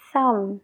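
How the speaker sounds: "thumb" ends on the m, b silent. Shown as a demonstration. Teacher repeats "thumb" for learners. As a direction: let "thumb" stop at the m. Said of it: "no se pronuncia la B".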